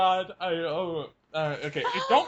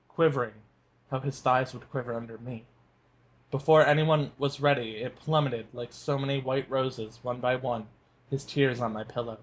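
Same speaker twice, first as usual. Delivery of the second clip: lower, measured book reading; story narration; 7 semitones lower than usual. A narrator's reading. Cohost reads the novel passage to listeners, calm narration. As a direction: read the scene calmly as a narrator.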